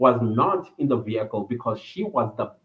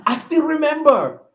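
delighted; 13 semitones higher, 5 dB louder than usual. Real, not acted. Laughing fond remembrance.

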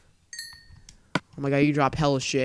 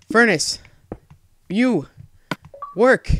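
loud, high, one word at a time; each word punched out separately, loud and exasperated; frustrated command; 7 semitones higher, 7 dB louder, slower than usual. Real, not acted. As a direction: order a stubborn machine about. Ordering the game furnace to work.

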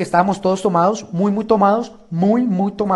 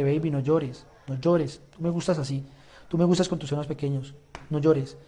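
voz baja y suave de consuelo; consuélala bajito, más grave y suave; consolando; 4 semitones lower, 8 dB quieter than usual. Retold or imitated. Consoling her while she cried. (consolando con ternura, en voz baja)

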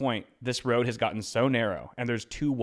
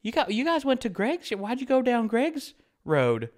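put-on old lady scolding strangers on her yard; quirky old lady; 12 semitones higher, 4 dB louder than usual. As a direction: play the odd old neighbour, pitched way up. Old lady voiced in story.

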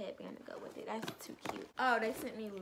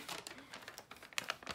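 airy soft laugh; 5 semitones higher, 6 dB quieter than usual. Real, not acted. Laughs at fans' kindness.

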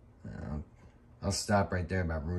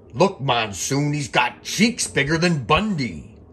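slow, higher, louder put-on announcer voice; commentator impression; 6 semitones higher, 6 dB louder, slower than usual. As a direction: ham up an old wrestling broadcaster. Quoting WrestleMania commentary.

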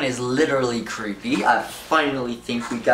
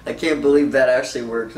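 ordinary delivery

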